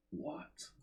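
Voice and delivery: breathless stunned whisper; whispered what. Real, not acted. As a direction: mouth it in disbelief. Stunned by declined draw.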